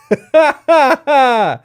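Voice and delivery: shrill burst of laughter; high-pitched laughing. Real, not acted.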